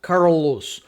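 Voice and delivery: put-on American-accented "Carlos" with curled R and loose L. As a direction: imitate an American saying "Carlos". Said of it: "se o americano fosse falar o Carlos".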